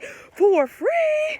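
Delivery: can't believe what you just heard; mock shocked echo; incredulous. Disbelief at free house offer.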